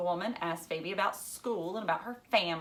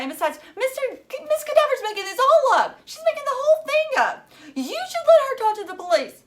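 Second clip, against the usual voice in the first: put-on much higher, louder upset girl; a furious young girl, much higher and louder; upset girl shouting; 13 semitones higher, 8 dB louder than usual. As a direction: rant at her father, near tears. Phoebe shouting at father.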